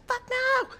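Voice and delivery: shouted refusal. Retold or imitated. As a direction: shriek the refusal. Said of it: "And she's like".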